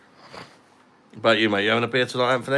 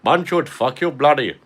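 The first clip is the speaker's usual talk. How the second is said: loud, higher-pitched mock Indian accent; 6 semitones higher, 8 dB louder than usual. Streamer showing off an accent for chat, mock Indian accent. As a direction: put on a hammed-up Indian accent, loudly. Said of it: "Indian accent".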